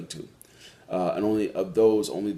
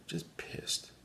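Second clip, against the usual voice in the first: whispered; 11 dB quieter than usual. Real, not acted. Repeats the word hushed.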